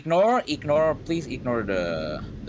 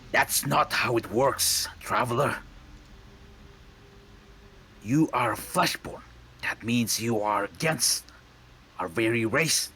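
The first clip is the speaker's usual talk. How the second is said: slow, stern robotic guard voice; cold Warforged soldier; 3 semitones higher than usual. Acted. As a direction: lay down the law to a stranger. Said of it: "the Warforged is like".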